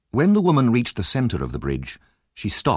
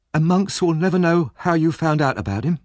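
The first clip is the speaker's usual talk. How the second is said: put-on higher, anxious young woman's voice; Nancy, tense and fearful, pitched up; frightened young Nancy; 9 semitones higher, 3 dB louder than usual. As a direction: voice the scared girl on edge. Nancy's voice held in reading.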